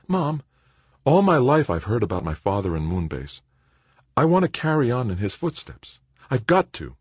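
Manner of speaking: high-pitched earnest appeal; insistent, heartfelt son; earnest pleading. Acted. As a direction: plead with mother to let you go. Son pleads to join expedition.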